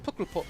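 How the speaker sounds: "Pukkelpop" said as pook-el-pop, short clipped Flemish vowels; Flemish pronunciation attempt. Shown as a demonstration. Streamer copying a pronunciation guide, careful Flemish try. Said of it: "I'm trying".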